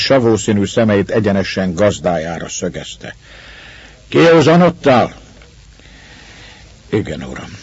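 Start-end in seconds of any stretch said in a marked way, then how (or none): 4.1-5.2 high, raised question; stern questioning. A narrator's reading. Master checking on the sobered Russian, stern questioning. 6.9-7.6 low, soft, submissive reply; reply humbly under your voice; quiet answer. A narrator's reading. Sobered Russian answering his master's questioning, quiet answer. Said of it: "csöndesen felelt".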